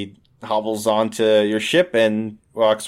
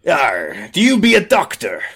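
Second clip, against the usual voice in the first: pirate diplomat; 2 semitones higher than usual. Acted. Pirate questions the amputation critic, pirate voice. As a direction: growl the yar, then challenge.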